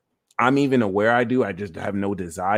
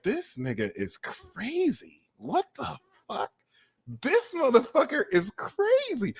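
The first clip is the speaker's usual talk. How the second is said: softer, rising mock disbelief; soft shocked aside; 12 semitones higher, 6 dB quieter than usual. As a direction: mutter it, weirded out, then higher. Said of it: "the more you're like".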